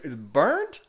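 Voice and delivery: high imitation of his girlfriend mishearing; mock confused question. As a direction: echo it back, puzzled and high. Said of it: "She's like".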